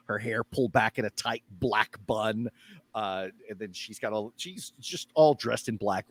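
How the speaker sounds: usual speaking voice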